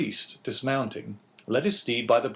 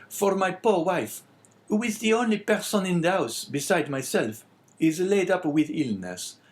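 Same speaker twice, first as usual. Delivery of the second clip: high innkeeper voice, dropping at the end; plaintive innkeeper; 7 semitones higher than usual. Acted. Innkeeper telling of his sick wife, plaintive.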